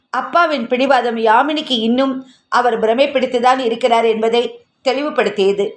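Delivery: ordinary delivery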